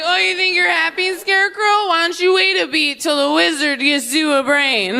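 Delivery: mock cruel heckler. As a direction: talk down to the Scarecrow. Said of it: "It's like".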